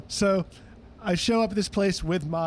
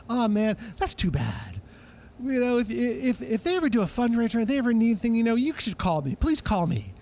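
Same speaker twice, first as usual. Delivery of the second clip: [name] voice; 6 semitones higher than usual. Retold or imitated. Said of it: "He said".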